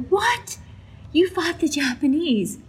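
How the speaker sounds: astonished. Retold or imitated. Reacting to a hundred-year-old vet, astonished. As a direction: jump up in pitch, stunned. Astonished at veteran's war story.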